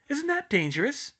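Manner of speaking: very high, anxious schoolgirl; squeaky alarm. A narrator's reading. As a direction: squeak with worry. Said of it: "squeaked Hermione".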